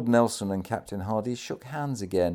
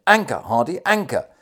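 loud, high, urgent command; raised voice of a commander insisting; emphatic exclamation; 6 semitones higher, 9 dB louder than usual. A narrator's reading. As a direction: call the order out forcefully, rising on the repeat. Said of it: "and then emphatically exclaimed".

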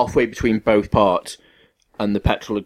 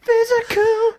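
much higher squeaky "physical", drawn out as a joke; high mock squeal; slower than usual. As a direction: jump way up and stretch "physical".

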